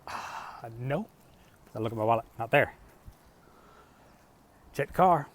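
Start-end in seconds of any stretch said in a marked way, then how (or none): 0.1-1.1 dismayed whisper. Retold or imitated. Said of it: "And I'm like".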